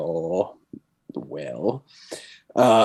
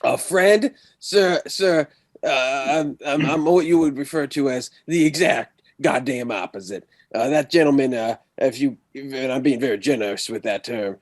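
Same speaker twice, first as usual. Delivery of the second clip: put-on a shaky, higher-pitched stab at a Texan accent; attempted Texan accent; 3 semitones higher than usual. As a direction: play a Texan lawman and miss the accent a bit. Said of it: "this is definitely not a Texan accent".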